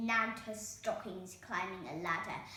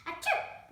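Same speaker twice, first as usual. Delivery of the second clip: sneeze; 9 dB louder than usual. A narrator's reading. Cinderella sneezes.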